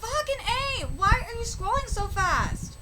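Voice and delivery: high-pitched frustrated swearing; exasperated outburst. Real, not acted. Cursing the runaway scrolling page, high exasperated complaint. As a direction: let the irritation burst out at the screen.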